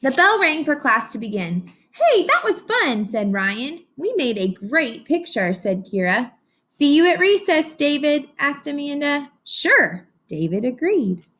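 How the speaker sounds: lively read-aloud storytelling; story narration. A narrator's reading. Teacher reading to her class, animated storybook narration. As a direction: read it like a picture book to a class.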